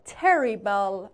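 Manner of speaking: "Terrible" said slowly with both r sounds clear; slow "Terrible", each r rolled out; careful r sounds. Shown as a demonstration. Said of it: "دو تا آر داره".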